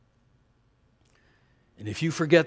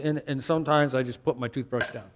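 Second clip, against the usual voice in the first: normal talking voice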